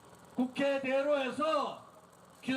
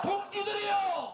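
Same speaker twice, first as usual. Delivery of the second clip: roaring rallying speech; 5 semitones higher than usual. Real, not acted. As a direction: rouse the crowd with a shout. Slogan chanted for crowd.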